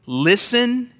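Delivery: Listen said high and loud; Listen as a loud command. Shown as a demonstration. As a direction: bark the word Listen as an order. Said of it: "two imperatives, two commands".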